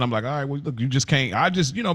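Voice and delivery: usual speaking voice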